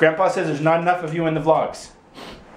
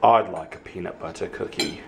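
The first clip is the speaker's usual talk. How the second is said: low, softer answer; low playful reply to the offer; low-voiced reply; 7 semitones lower, 4 dB quieter than usual. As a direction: drop the voice to answer yourself. Answers own high-voiced question.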